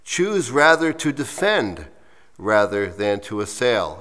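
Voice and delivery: measured poem recital; slow reading. Real, not acted. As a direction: read it slowly and deliberately. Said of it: "read it to you slowly".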